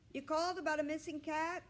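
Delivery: high, loud call from behind a door; yelling through door. A narrator's reading. Rescue man yelling through the front door, high and loud. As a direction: yell it through the closed door.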